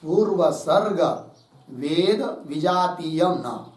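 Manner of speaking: slow, word by word for writing down; slow dictation of पूर्व सर्ग वेद विजातीयम न. Shown as a demonstration. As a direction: dictate each word slowly so students can write. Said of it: "मतलब लिखिए उसका अर्थ".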